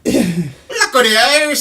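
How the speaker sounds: laughing stab at an Irish accent. Shown as a demonstration. Cohost put on the spot for Irish, hammy Irish accent. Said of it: "Can you do an Irish one?".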